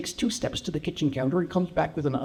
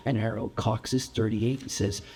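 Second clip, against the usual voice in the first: ordinary talk, nothing marked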